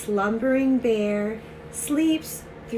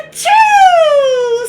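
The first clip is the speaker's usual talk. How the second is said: loud, high, long-drawn achoo; huge mock sneeze; 15 dB louder than usual. Pepper made the bear sneeze, huge mock sneeze. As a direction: explode into a drawn-out storybook sneeze.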